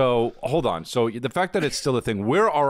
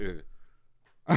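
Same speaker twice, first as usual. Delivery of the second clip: usual speaking voice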